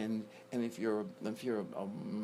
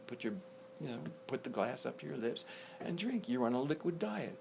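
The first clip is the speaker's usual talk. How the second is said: normal talking voice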